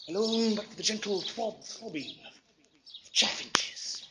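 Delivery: breathy whisper, slow and fading; hushed. Acted. Poet ending his reverie, slow hushed whisper. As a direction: let the last words die away in a whisper.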